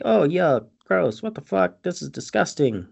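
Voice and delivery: disgust. Retold or imitated. Acts out Toby disgusted by dead horse.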